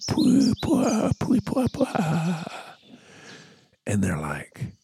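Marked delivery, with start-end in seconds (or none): 0.1-2.5 high, robotic vocoder warble on pwah; vocoder imitation. Bon Iver answering the chicks, mock vocoder warble. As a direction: mimic an autotuned vocoder note, pitched high. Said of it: "like with the fucking vocoder".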